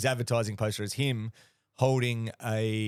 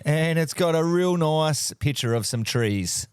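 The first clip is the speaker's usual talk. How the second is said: mock British accent; 4 dB louder, slower than usual. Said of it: "my fellow pommies".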